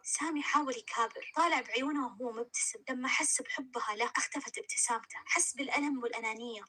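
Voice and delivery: plain reading voice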